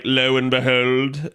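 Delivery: slow, mock-old-fashioned proclamation; medieval speech. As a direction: lightly play a medieval herald. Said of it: "talking like a medieval person".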